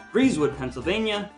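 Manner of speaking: ordinary talk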